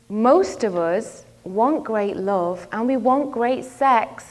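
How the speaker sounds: ordinary talk, nothing marked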